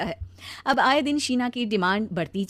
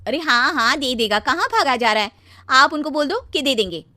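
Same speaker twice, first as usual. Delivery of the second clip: irritated dismissal; 4 semitones higher, 7 dB louder than usual. Acted. Wife brushes off repayment request.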